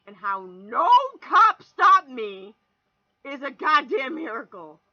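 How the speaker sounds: slow, loud, stretched words; milk the miracle for drama; drawn-out emphasis. Real, not acted. Bragging about speeding unpunished.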